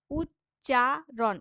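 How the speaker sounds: উচ্চারণ split slowly into its syllables, উচ্-চা-রণ. Shown as a demonstration. Teacher breaking উচ্চারণ into syllables for students, slow and split. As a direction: say উচ্চারণ one syllable at a time. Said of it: "এরকম করে উচ্চারণ করছি".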